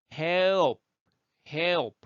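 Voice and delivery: ordinary talk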